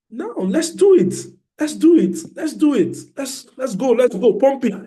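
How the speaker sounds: hype. Real, not acted. Hyping up the class.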